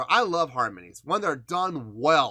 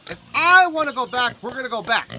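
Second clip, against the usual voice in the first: loud, high mock-stubborn insistence; hammed-up defiant protest, much higher and louder; playful defiance; 11 semitones higher, 8 dB louder than usual. Insisting to viewers he'll replay the song, hammed-up defiant.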